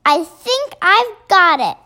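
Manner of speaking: high, bursting exclamation, easing slightly; excited cry. A narrator's reading. Sophie suddenly finds a plan, crying out excitedly. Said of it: "in a voice filled with excitement".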